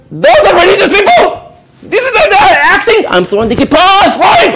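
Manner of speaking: high, loud outburst broken by laughter; mock outraged yell. Client who lost the villa yelling about the religious lawyer, laughing outrage. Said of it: "I would have said".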